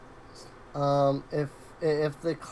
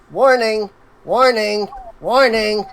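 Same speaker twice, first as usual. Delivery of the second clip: loud, higher-pitched repeated alarm warning; screaming alarm; 5 semitones higher, 6 dB louder than usual. Acted. Game master voices the exploding disposer's alarm, blaring. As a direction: blare it out as a machine's alarm, each word alike. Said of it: "that screams".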